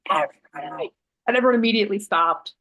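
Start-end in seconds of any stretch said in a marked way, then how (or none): 0.1-0.9 low, commanding call without shouting; manifestor voice. Retold or imitated. Participant asked to circle everyone up, low manifestor voice. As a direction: imitate a deep voice that stops a room. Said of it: "Can you use your manifestor voice".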